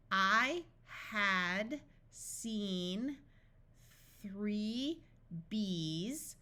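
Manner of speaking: very slow, a gap after each word; rising tone on each separate word; beginner's practice reading, drawn out. Shown as a demonstration. Practice sentence read word by word.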